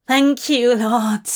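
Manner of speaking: a grateful, half-sobbed thanks. Acted. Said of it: "Abraham sobbed".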